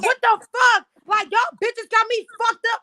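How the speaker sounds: high, loud indignant outburst; fired-up, high-pitched protest; retold outrage. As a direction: re-enact snapping after being shoved. Retells outrage at being pushed in.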